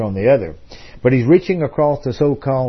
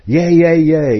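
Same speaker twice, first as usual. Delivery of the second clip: mock crowd cheer, higher and a bit louder; imitating crowd cheering; 5 semitones higher, 5 dB louder, slower than usual. Recalling the crowd's cheer for Ron Paul, a mock excited yell. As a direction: imitate the crowd yelling for him.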